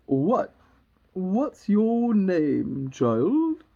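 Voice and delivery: loud, drawn-out regal demand; imperious Queen. Acted. Queen questioning Alice, slow and imperious. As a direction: stretch the words out grandly and loudly.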